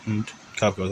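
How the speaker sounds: ordinary talk, nothing marked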